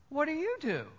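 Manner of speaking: imitated stranger's soft, high-pitched question; seat partner asking back.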